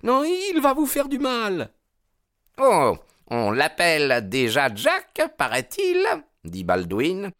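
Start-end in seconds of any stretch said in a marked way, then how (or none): 0.0-1.7 very high, louder female plea; distraught cry. A narrator's reading. Hetty begging Jack not to fight, distraught. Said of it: "s'écria la pauvre Hetty bouleversée". 2.6-6.0 high, loud mocking taunt; jealous jeer at the first name; sneering. A narrator's reading. Baldwin hearing her call him Jack, sneering.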